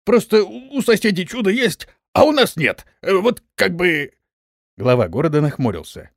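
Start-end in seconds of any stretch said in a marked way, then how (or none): 0.1-4.1 high, hesitant excuse-making; backpedalling general. A narrator's reading. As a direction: back down hastily in a much higher voice. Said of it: "поспешил сдать назад генерал". (высоким голосом, сдавая назад)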